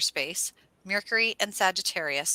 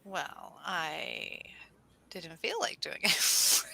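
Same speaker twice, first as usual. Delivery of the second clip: reluctant backing down; 2 semitones lower, 6 dB quieter, much slower than usual. Acted. Backing down after the fired-up boast.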